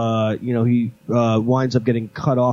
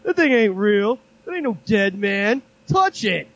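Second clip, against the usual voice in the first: imitating doofy guy; 8 semitones higher than usual. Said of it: "And he's like".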